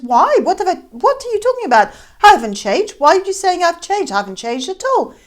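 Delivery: loud, high, indignant denial; indignant protest. Acted. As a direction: protest hard, stung and defensive. Scrooge protests Mary's accusation.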